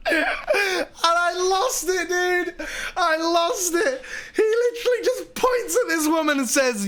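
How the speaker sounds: high, squeaky voice through laughter; real laughing fit. Real, not acted. Telling how they lost it, cracking up. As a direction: struggle to speak while laughing hard.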